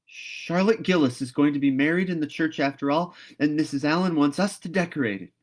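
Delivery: put-on excited girl guessing fast; excited Anne guessing. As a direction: blurt it out eagerly. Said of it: "cried Anne".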